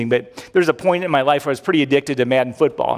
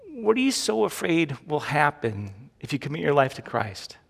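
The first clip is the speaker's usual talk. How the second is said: usual speaking voice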